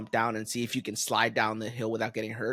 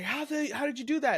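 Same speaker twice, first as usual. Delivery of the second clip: put-on kids; 11 semitones higher than usual. Kids shocked at broken arm.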